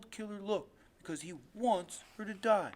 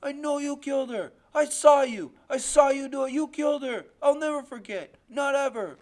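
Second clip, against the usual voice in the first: put-on high, loud, frantic child's shouts; screaming; 6 semitones higher, 11 dB louder than usual. A narrator's reading. Boy screams at the man choking Loretta, frantic accusing scream. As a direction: keep screaming the accusation at him. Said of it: "all I can do is keep screaming".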